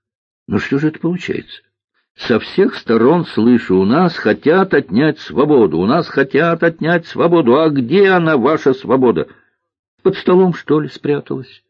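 high, indignant mock of a slogan; exasperated. Girl challenges cook about hidden freedom, exasperated.

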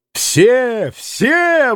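high, soaring, jubilant cry; exclaiming. A narrator's reading. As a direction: welcome everyone in, pitch leaping up. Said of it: "восклицал Митя".

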